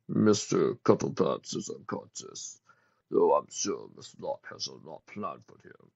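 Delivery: low, slow, menacing whisper; sinister minion. A narrator's reading. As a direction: gloat quietly over the prisoner. Villain minion gloating.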